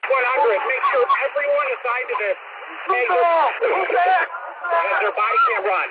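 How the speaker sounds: loud, high-pitched urgent orders; real shouted radio order; yelling. Real, not acted. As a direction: yell commands over the chaos. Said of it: "multiple units yelling over each other".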